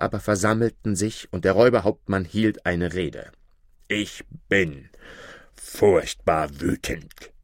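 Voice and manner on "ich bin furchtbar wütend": low, slow growl of the robber chief; a gruff captain venting his rage; hammed-up furious bandit leader; robber chief, angry. A narrator's reading. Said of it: "rief er".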